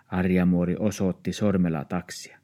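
ordinary delivery, nothing marked

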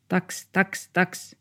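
high yappy barks; little dog barking. Acted. Small dog barks at two dogs outside, high yaps. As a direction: yap like a tiny dog.

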